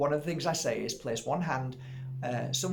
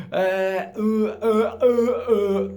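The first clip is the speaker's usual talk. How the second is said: louder, higher hesitating uhs; panicked stammer; 7 semitones higher, 9 dB louder, much slower than usual. Retold or imitated. Healer caught out by a forgotten face, loud panicked stammer. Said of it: "as I am panicking".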